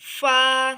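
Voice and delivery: फा with top teeth on the lip, loud; teeth-on-lip letter. Shown as a demonstration. Teacher showing lip position, teeth-on-lip letter. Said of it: "अगले ऊपर वाले दो दांत लगने चाहिए".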